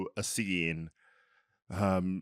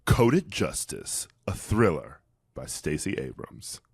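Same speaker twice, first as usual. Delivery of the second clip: slow, suspenseful trailer read, dropping lower at the end; thriller voice; 5 semitones lower than usual. Pretend. Host introducing a thriller sponsor to co-host, hammy thriller voice. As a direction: draw out the title ominously, like a mock horror announcer. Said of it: "Do you like my thriller voice?".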